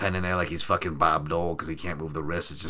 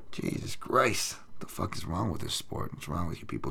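whispered disbelief; 2 semitones lower, 9 dB quieter than usual. Real, not acted. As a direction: mutter it under your breath in disgust. Disbelief at the card.

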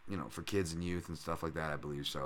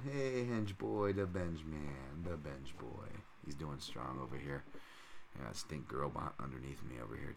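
slow, softer pet talk; 5 semitones lower, 10 dB quieter, slower than usual. Real, not acted. Host murmuring to his dog under the desk. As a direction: coo to the dog lying below.

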